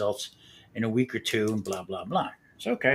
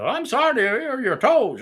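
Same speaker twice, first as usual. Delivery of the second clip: higher-pitched mock Indian apology; imitating the Indian technician; 10 semitones higher, 4 dB louder, slower than usual. Technician correcting himself to the patient, mock Indian accent. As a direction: correct yourself sheepishly in his voice. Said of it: "he goes".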